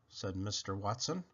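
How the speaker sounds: usual speaking voice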